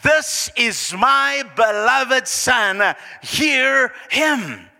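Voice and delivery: loud, high, slow divine proclamation; booming voice of God; voice from heaven. Acted. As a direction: proclaim it from the clouds, stretching each word. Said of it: "a voice came out from the cloud".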